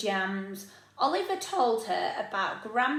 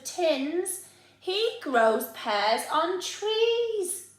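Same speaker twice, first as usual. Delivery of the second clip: put-on higher, louder, slow insistent boy; indignant child; 7 semitones higher, 6 dB louder, much slower than usual. Oliver refusing tinned pears, higher and insistent. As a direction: protest like a stubborn little boy.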